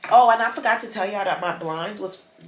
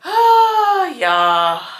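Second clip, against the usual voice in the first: weary wail; 10 semitones higher, 11 dB louder than usual. Real, not acted. Sick and fed up, venting.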